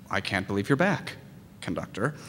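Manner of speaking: low, hushed imitation of disbelief; quiet, reluctant mock greeting; weary disbelief. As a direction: say it low and under your breath. Weary professors greet a returning student.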